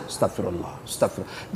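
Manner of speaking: quiet whispered repentance; whispered prayer. Retold or imitated. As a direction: breathe "astagfirullah" under the breath. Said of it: "lawan itu iblis".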